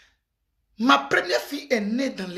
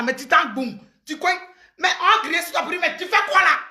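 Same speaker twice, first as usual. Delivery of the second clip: normal talking voice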